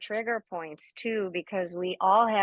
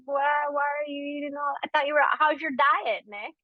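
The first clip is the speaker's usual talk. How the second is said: higher, mock-prying friend's voice; imitating a nosy friend; 7 semitones higher than usual. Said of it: "she's gonna be like".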